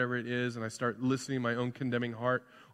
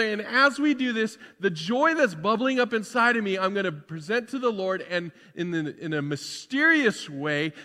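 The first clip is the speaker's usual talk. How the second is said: high and loud, fired-up preacher; rousing pulpit voice lifting the whole room; full-force preaching; 9 semitones higher, 10 dB louder than usual. Acted. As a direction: preach it out to the back row.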